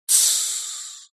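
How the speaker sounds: long soft "shh"; hushing shush. A narrator's reading. Роза Марена with a finger to her lips, hushing. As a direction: hiss a quiet, drawn-out shush. Приложила палец к губам.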